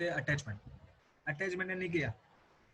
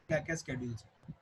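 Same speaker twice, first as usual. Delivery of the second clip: usual speaking voice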